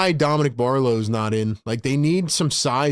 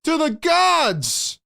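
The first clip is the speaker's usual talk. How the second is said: thrilled commentator riding the peak; ecstatic play-by-play. Real, not acted. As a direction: celebrate the highlight at a high pitch. Celebrating the dunk live.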